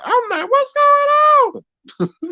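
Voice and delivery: very high, slow, stretched squeaky voice; chopper voice. Pretend. Streamer asking chat about StreamYard, in a squeaky chopper voice. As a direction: drag it out in a high cartoon squeak. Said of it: "chopper voice".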